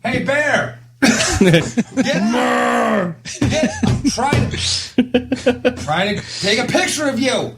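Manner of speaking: high mock yell at a bear; scaring off a bear. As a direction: holler at the bear to get up. Yelling at bear for photo.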